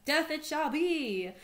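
dramatic sentence. Acted. Odysseus sentencing the pleading diviner.

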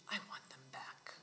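quiet, whispered order from a Don; hushed menacing demand. Acted. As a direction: drop to a near whisper. Don quietly demands his things.

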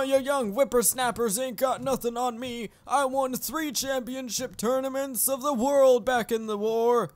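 hammed-up old codger voice; old man. Old man bragging to young whippersnappers, put-on codger voice. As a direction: play a boastful old veteran. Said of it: "Come on, old man".

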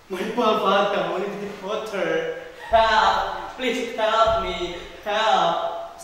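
loud, high cries for help; shouted plea. Acted. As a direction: call out in distress, drawing the words out. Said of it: "shouted the princess".

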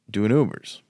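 ordinary delivery

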